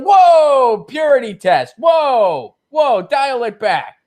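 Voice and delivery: high, loud, sneering "whoa, dial it back"; hammed-up establishment scoff; mocking dismissal. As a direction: brush off the anti-war critic as a purist. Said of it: "It's just like".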